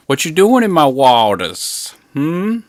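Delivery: threatening swamp local; backwoods hillbilly. Retold or imitated. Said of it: "very much Deliverance style".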